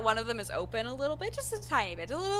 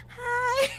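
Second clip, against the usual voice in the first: excited hi; 13 semitones higher, 4 dB louder than usual. Acted. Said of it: "goes over to Arya and".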